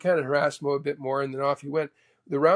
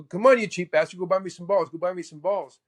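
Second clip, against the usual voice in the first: high, laughing taunt at the heckler; mock Mo taunting a cheap heckler; imitating Mo; 4 semitones higher, 3 dB louder than usual. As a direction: tease the guy like Mo, higher pitched. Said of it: "and Mo go".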